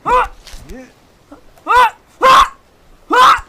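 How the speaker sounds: short, high, loud screams; panicked yelps. Real, not acted. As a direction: yelp sharply each time. Repeated panic while climbing.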